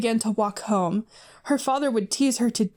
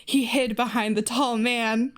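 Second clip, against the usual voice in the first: ordinary delivery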